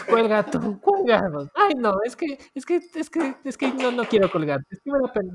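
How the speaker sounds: high, giggly lovesick voice; giggly late night call. Retold or imitated. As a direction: coo like a lovesick teen on the phone. Lovers won't hang up phone.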